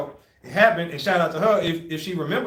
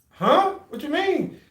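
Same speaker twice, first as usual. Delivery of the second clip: high-pitched, incredulous questioning; baffled; 11 semitones higher, much slower than usual. Retold or imitated. Recruit questioning the examiner, baffled. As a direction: squeak it in mock bewilderment. Acts out his baffled younger self.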